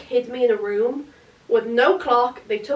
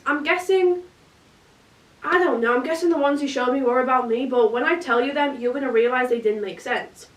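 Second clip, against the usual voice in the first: ordinary talk, nothing marked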